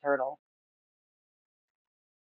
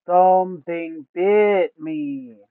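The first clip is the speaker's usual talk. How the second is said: slow Gar; 2 semitones higher, 6 dB louder, much slower than usual. A narrator's reading. Giant turtle Gar waking up, speaking slowly. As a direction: drag each word like a sleepy giant. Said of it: "Gar says slowly".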